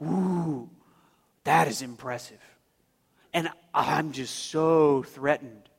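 low, drawn-out sarcastic awe; slow mock-impressed drawl; mocking God. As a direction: feign being impressed and threatened. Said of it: "see God mocking them".

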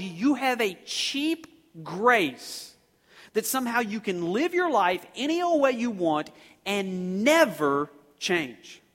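slow, loud emphatic preaching; preacher hammering the congregation; preaching at full force. Acted. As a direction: drive each phrase home, slowly.

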